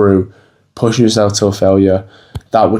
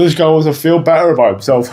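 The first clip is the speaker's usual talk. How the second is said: mocking sneer; 7 semitones higher than usual. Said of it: "No one's gonna say that".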